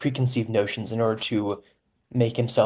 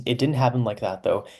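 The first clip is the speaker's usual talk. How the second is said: usual speaking voice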